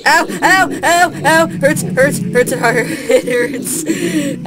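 high loud comic ow; hammed-up pain yelps. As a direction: yelp in mock pain. Getting hit by mobs.

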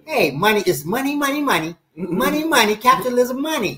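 mock Buckhead money chant; Buckhead money talk. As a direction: ham up a rich Buckhead guy. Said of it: "that's the language they speak".

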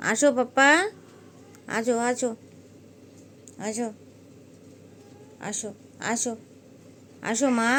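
loud coaxing call; loud calls to the cats in a dark room. Real, not acted. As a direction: call the kittens over, pleading.

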